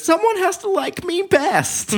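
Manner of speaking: high falsetto whine; mock breakdown. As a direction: wail it like a breaking wife. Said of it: "she says".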